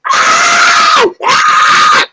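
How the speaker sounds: loud, high screams; imitated screaming. Kid's mom horrified by the pus, loud screaming. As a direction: scream in two harsh bursts.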